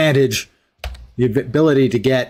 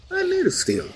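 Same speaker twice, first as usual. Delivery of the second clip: high mock voice; 5 dB quieter than usual. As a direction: squeak it out mockingly. Mocking Superman.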